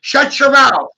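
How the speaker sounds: high, loud furious scolding; angry parent yell. Retold or imitated. Parents shouting at their five-year-old to shut up, furious. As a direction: bark down a child who speaks up. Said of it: "And the parents are like".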